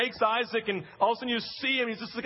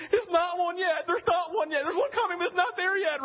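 high, urgent, repeated plea; anguished inner plea; 8 semitones higher than usual. Retold or imitated. Preacher inwardly answering Isaac about the lamb, high anguished urgency. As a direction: plead helplessly at the screen. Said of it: "I'm sitting there just inside going".

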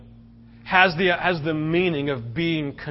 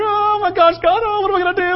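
high, loud panicky wail; mock panic; 13 semitones higher, 4 dB louder, faster than usual. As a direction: ham up a frantic, helpless cry. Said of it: "We're over here".

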